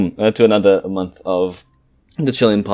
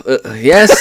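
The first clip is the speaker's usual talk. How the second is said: normal talking voice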